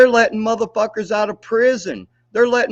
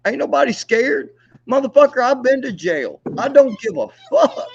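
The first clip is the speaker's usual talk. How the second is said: angry yelling; 6 semitones higher, 5 dB louder than usual. Real, not acted. Angry threats in a quarrel.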